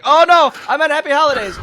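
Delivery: high, loud panicked correction; flustered correction. Retold or imitated. Man calling from his car to the clerk, flustered loud correction. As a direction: shout it out of the car window. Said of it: "be like".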